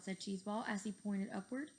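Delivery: ordinary delivery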